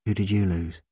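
soft, gentle asking; quiet question. A narrator's reading. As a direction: ask softly of someone grieving. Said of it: "he asked quietly".

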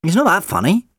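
high, loud indignation; high indignant. Acted. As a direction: protest it indignantly. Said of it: "the doctor said".

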